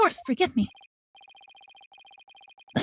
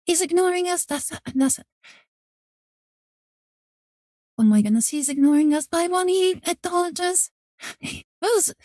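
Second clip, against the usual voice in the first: excited fangirl; 5 semitones higher than usual. Real, not acted. Fangirling at a game character.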